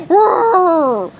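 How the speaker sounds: high, loud mournful roar; sad moaning roar. A narrator's reading. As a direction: roar out the heartbreak. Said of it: "he moaned sadly".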